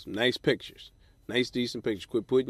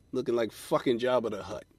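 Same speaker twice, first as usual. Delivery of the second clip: ordinary talk, nothing marked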